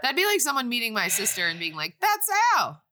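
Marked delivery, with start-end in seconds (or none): 2.0-2.8 high, loud mock greeting; mock delighted recognition. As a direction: call it out as if spotting someone. Imagined stranger mistaking sister.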